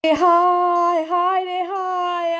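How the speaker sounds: loud wailing alas; aunt wailing over her ruined sofa sheet; dismayed lament. Real, not acted.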